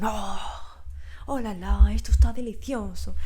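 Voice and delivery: badly faked French accent; hammed-up French accent, oh la la. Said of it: "me ha salido súper mal el acento francés".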